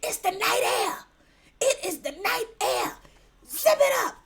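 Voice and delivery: high, loud scolding mom imitation; nagging mother. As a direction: order them to bundle up like a fussing mother. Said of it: "my mother uses that like nobody's business".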